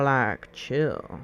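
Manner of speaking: usual speaking voice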